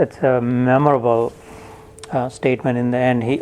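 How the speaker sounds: usual speaking voice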